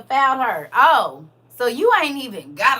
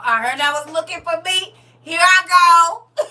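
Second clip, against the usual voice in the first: cash doll voice; 4 semitones higher, 7 dB louder than usual. Shown as a demonstration. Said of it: "Come on".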